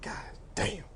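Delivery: whispered under breath. Retold or imitated. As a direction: whisper it to yourself. Judge muttering under breath.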